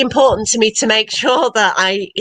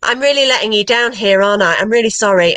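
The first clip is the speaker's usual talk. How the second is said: ordinary delivery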